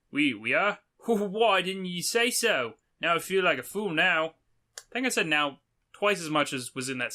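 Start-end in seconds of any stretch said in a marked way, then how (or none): 0.1-4.3 badly faked Aussie with a laugh; hammed-up sheepish Australian; terrible Australian accent. Traveller feeling a fool before the knight, bad Australian accent. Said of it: "read this in a terrible accent".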